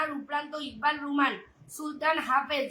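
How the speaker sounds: ordinary talk, nothing marked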